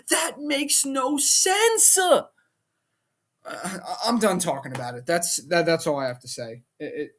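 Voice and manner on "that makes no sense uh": very slow, much higher, dragged-out disbelief; incredulous. Real, not acted. Fan fed up with booking, slow incredulous protest. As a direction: spell out how absurd it is. Ranting about McAfee reveal.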